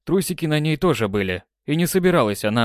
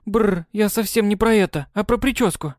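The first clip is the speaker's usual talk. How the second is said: clarifying; 9 semitones higher than usual. Acted. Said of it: "начала было уточнять".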